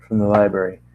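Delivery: ordinary delivery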